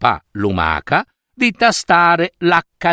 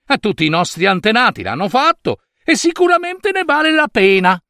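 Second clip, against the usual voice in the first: higher and faster, dreaming of the castle; eager snail mother carried away by her dream; 7 semitones higher than usual. Acted. (mamma lumaca sognante ed esuberante, voce più acuta e veloce)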